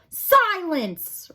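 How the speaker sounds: high, loud roar for silence. A narrator's reading. Head mouse silencing the crowd, roaring. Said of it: "roared the most very honored head mouse".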